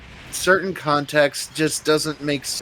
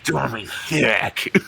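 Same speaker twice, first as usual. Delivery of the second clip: slow gruff Ego Raptor snake voice; slower than usual. Pretend. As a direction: drag it out like Solid Snake parody. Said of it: "when you say thick, you slip into, like, the, uh, Ego Raptor, uh, snake".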